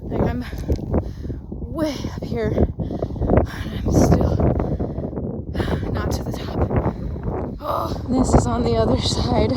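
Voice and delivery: panting, pushing words out; breathless climbing update; out of breath. Real, not acted.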